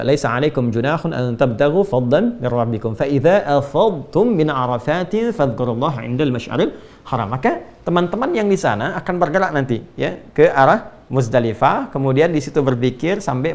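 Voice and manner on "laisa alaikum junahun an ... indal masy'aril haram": low, measured Arabic recitation; Quran recitation. Acted. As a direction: recite the verse in a lower, even voice. (tilawah Al-Qur'an)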